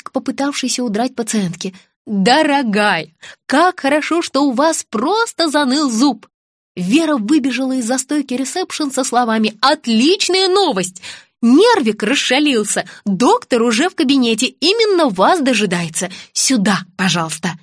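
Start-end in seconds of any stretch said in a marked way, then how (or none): 2.1-6.3 slow, effusive sweetness; syrupy, stretched-out reassurance; gushing. Acted. Staff gush to stop fleeing patient. 9.6-17.6 delighted receptionist, coaxing; effusive welcome. Acted. Receptionist joyfully ushers patient in.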